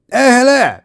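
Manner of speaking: high, loud mock-posh British greeting; hammed-up plummy British hello; British accent. Shown as a demonstration. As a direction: call out in exaggerated posh British. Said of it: "say hello in a delightful British accent".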